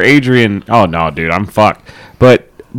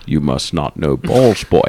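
Sean Connery; 8 semitones lower than usual. Acted. Answering a doubting friend about balls, Sean Connery impression. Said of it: "Was that your Sean Connery voice?".

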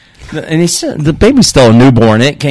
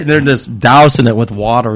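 usual speaking voice

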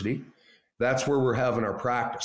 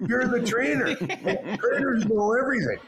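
normal talking voice

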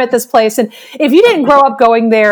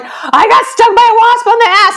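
high, urgent announcement; hammed-up breaking news to friends; retold alarm; 9 semitones higher than usual.

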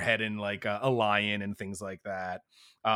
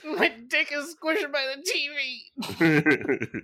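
high, slow crying whine; 5 dB louder, slower than usual. Retold or imitated. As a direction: whine it out, tearful. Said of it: "and he's crying".

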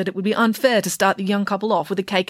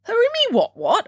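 high puzzled squeak; totally baffled by the big word. Acted. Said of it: "asked Nanny Piggins".

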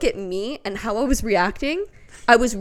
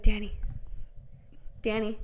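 softly calling a name to wake someone; tentative calling; 9 dB quieter than usual. Retold or imitated. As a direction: try his name quietly, without alarm. First gentle attempt to wake husband.

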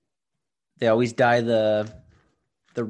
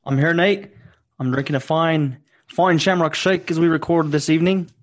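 hammed-up Irish accent, pitched higher and louder; poor Irish accent; 6 semitones higher, 5 dB louder than usual. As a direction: play a jolly stage Irishman. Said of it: "our poor attempt at an Irish accent".

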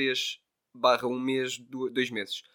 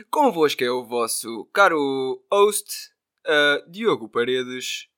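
announcer voice; 10 dB louder, much slower than usual. Pretend. Mock grand host introduction.

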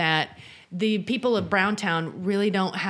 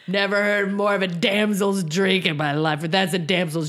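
louder, slurring scoff at champagne; drunk scoffing man; 4 dB louder than usual. Retold or imitated. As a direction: sneer like a drunk at the bar. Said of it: "Brown the Younger's all like".

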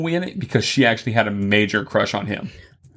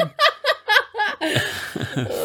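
high shrieking laugh; cackling laugh. Real, not acted. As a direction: burst into a squealing cackle. Laughing at demon-like sound.

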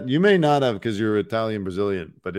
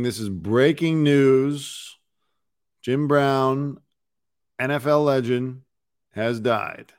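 news announcer; 4 semitones higher, slower than usual. Acted.

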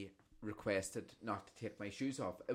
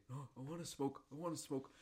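imitating granda; 2 semitones higher, 9 dB quieter than usual. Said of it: "granda insisting".

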